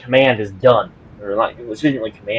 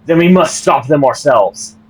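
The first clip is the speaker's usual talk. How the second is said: louder, resolute shout; yelled retort; 4 semitones higher, 6 dB louder than usual. Acted. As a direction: bark the order back at her. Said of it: "he yells back".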